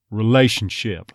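"relationship" with strong r, neutralized A; Texas "relationship". Shown as a demonstration. Coach models the next word, Texas "relationship". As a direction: start the "la" vowel from schwa in "relationship". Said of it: "neutralized all the way to a schwa".

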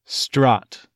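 "Strut" with a distinct open uh, near strat; English "Strut". Shown as a demonstration. Coach shows some England speakers, open "Strut". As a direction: open the vowel of "Strut" toward ah. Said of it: "more of a distinct uh".

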